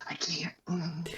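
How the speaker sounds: tiny embarrassed whisper; bashful whispered refusal. Retold or imitated. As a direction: whisper it, barely audible. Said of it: "Like, I will whisper it".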